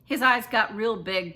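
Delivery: ordinary delivery, nothing marked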